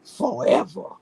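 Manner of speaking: a light put-on rapper swagger, forever stretched out. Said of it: "in my [name] voice".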